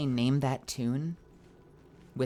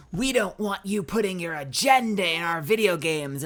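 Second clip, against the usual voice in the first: mocking troll voice; 3 semitones higher, 7 dB louder than usual. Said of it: "They're like".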